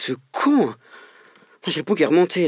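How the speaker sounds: ordinary talk, nothing marked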